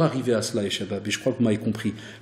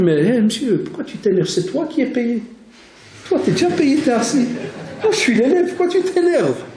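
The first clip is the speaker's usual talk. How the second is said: much higher and louder mock pupil voice, laughing at the end; insolent pupil; 11 semitones higher, 3 dB louder than usual. Teacher mimicking a pupil asking why he gets angry, high and loud. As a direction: protest like a smug pupil lecturing the teacher. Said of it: "Il y en a même qui m'a dit".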